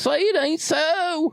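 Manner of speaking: high, drawn-out joking wail; mock lament. Friend teasing about the lost accent, mock lament. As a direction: wail it slowly in fake disbelief.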